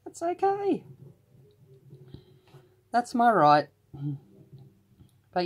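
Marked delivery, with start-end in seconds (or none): 0.0-3.7 playful defiance. Retold or imitated. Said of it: "I'm like".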